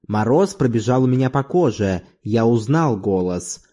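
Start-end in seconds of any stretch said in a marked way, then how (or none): none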